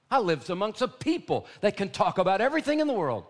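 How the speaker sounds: loud, high preaching about sin; impassioned preacher rebuking gossip; full-force preaching. Acted. Preaching rises again.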